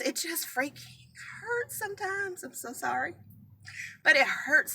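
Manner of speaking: the words crack with grief; choking up mid-sentence; voice breaking. Real, not acted. Grieving mother, voice breaking.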